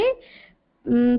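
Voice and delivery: ordinary delivery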